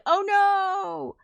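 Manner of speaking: high, loud mock gasp of dismay. The workers learning their children may be dead, in a high mock wail. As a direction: squeal it in cartoon horror. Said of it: "the workers are like".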